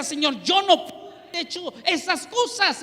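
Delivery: anguished plea of disbelief; pleading denial. Retold or imitated. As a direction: beg the Lord, protesting. Pleading to God in vision.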